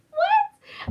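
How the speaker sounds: high, loud voice breaking with emotion; teacher moved to tears by the hug; teary squeak. Real, not acted. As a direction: choke up in disbelief. Overwhelmed, near tears.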